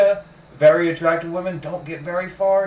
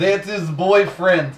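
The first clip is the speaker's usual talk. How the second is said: slow mock Russian accent; 2 semitones higher, slower than usual. Friend riffing on the Russian wife, slow mock accent. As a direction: drag it out in a bad Russian accent. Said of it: "Did not like either of your Russian accents".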